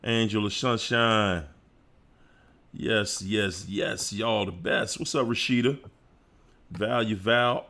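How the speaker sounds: normal talking voice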